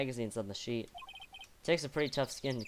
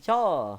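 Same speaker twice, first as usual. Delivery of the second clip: startled yelp; 3 semitones higher, 7 dB louder than usual. Acted. Startled by camera flash.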